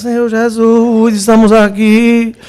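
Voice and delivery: slow, droning, drawn-out mock prayer; mock lifeless prayer. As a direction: drag out every vowel like a joyless worshipper. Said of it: "Parece que já morreu". (imitando prece arrastada, sem vida)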